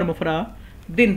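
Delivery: مفرد دن read mufradin, with an i in the ending. Shown as a demonstration. Teacher showing a valid reading, مفرد دن ending in -din. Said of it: "پڑھنا بھی درست".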